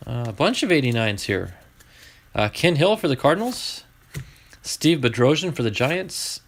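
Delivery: ordinary talk, nothing marked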